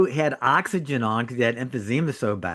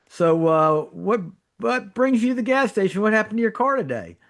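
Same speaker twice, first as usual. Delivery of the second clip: mock curious question in a much higher put-on voice; asking a stranger; 11 semitones higher than usual. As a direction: play a nosy guy at the pump. Imagined gas station chat.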